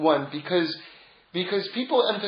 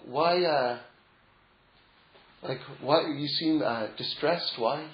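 hushed question; 4 semitones lower, 8 dB quieter, slower than usual. Retold or imitated. Disciples asking the dying tzaddik.

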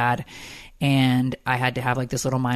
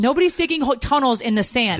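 incredulous disbelief; 9 semitones higher than usual. Real, not acted. Wife scoffing at her husband's tunnel claim, incredulous and high. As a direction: voice jumps much higher in disbelief.

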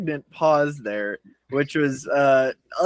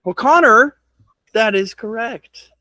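game show host; 8 semitones higher, 6 dB louder than usual. Acted. Quiz host announcing answer.

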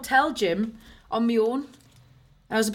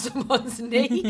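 ordinary talk

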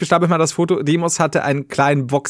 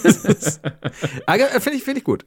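laughing; 6 semitones higher than usual. Real, not acted. Laughing at the railing joke.

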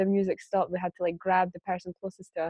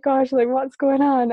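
amazed; 6 semitones higher, 6 dB louder, slower than usual. Retold or imitated. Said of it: "I was like".

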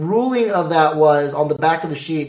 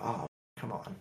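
frustrated groan; 6 semitones lower, 20 dB quieter than usual. Retold or imitated. Frustrated at opponent's opening.

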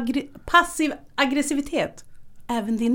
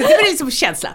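ordinary delivery

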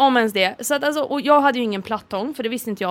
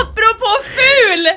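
high, loud, drawn-out exclamation; real excited shout; excited announcement; 11 semitones higher, 6 dB louder, slower than usual. Real, not acted. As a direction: burst in loudly with a new topic. Bursting into a new topic.

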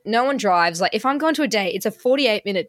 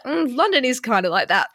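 higher, breaking into laughter; laughing comeback; 3 semitones higher than usual. Retold or imitated. Joking to her mum about London, laughing. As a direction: joke it off through a laugh.

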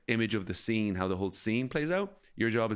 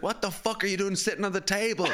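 mock furious director; 10 semitones higher than usual. Imagined director yelling.

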